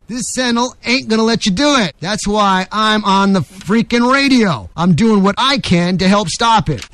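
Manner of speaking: emphatic, deliberate oratory; rally the listeners with a stump-speech cadence; radio editorial. Acted. Host warning listeners the country is in danger, rousing editorial.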